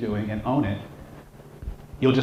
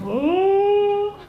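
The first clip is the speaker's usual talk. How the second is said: high, loud, held-out yelp; mock alarmed cry; 6 dB louder than usual. Clerk grabbed across the counter, high mock alarmed cry.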